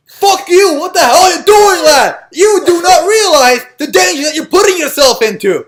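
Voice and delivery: very high, loud, angry shout; furious shouting actor. Retold or imitated. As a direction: scream it at Harry like a raging mentor. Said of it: "And the actor just goes".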